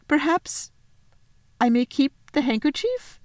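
high, halting faun voice; hesitant request. Acted. Faun asking Lucy for a keepsake, hesitant. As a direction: ask tentatively, pausing after the first word.

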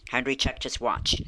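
plain reading voice

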